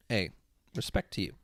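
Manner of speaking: ordinary delivery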